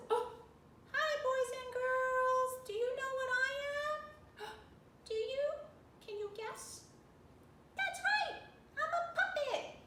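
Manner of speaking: high squeaky puppet character; bright high puppet talking to kids. Pretend. Teacher voices a puppet.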